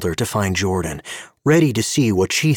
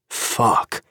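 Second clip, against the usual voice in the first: voiceless muttered curse; breathy curse; 2 semitones higher than usual. A narrator's reading. Gabe after gulping Tanya's beer: breathless whispered curse. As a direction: let it out under the breath. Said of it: "he said when he had finished".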